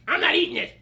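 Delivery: put-on high, loud childish refusal; kid tantrum. Small child refusing dad's onion hash in a tantrum, loud. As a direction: stamp it out like a sulky kid. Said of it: "threw a little temper tantrum".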